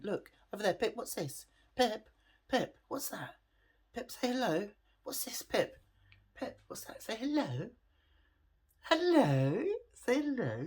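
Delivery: high-pitched puppy talk; coaxing a puppy. Real, not acted. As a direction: coax a dog in a high singsong. Coaxing the puppy to greet viewers.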